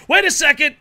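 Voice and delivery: high, loud alarmed call; excited shout. Real, not acted. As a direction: shout it at the screen. Match near fall.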